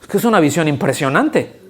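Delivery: normal talking voice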